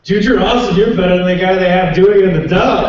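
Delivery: put-on loud, high drunk gushing; drunk fan. Drunk fan praises voice actor, loud imitation. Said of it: "very drunk friend of theirs".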